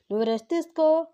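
emphatic insistence. A narrator's reading. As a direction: push తీసుకో up hard, insisting. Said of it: "అని అంది వైదిక".

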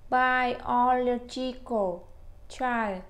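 Biological said syllable by syllable, very slow; drawn-out teaching model of Biological; slow model word. Shown as a demonstration. Teacher introducing a hard word to students, very slow. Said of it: "cái từ này nó hơi khó phát âm".

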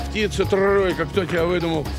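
ordinary talk

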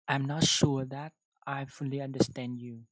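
careful model sentence, not punched; loud, slow demonstration read; slow clear reading. Shown as a demonstration. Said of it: "chúng ta sẽ đọc cái câu".

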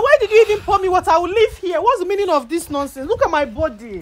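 high-pitched, heated scolding; indignant complaint. Real, not acted. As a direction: demand an explanation in outrage. Angry at being doused with water.

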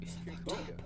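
whisper. Real, not acted. Shocked aside at sim's rudeness.

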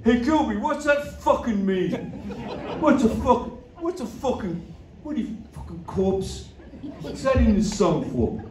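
high-pitched mocking caricature of a baffled bandmate. Bandmate questioning the singer's pretentious lyric, high baffled mock voice. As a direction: put on a high, uncomprehending voice and stumble over the question. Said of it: "And [name] said".

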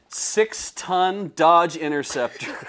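loud, slow, punched-out boast; laughing, big showy delivery; mock hype. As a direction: announce the winning car like a champion. Hyping the winning car, mock boast.

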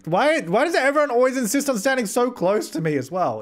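high-pitched, put-out protest; indignant whine about being crowded; exasperated complaint. Real, not acted.

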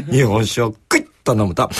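ordinary talk, nothing marked

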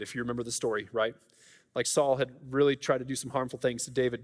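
normal talking voice

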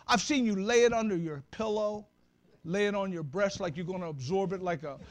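ordinary talk

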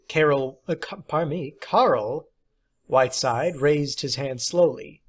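plain reading voice

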